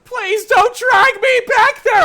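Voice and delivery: very high, loud sobbing beg; halfling begging while being dragged, crying; crying plea. Acted. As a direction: wail for mercy.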